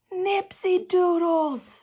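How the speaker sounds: high, loud, drawn-out shout; squealing kid's shout; excited shout. Acted. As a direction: yell the snack name with glee. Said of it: "shouted Lenny".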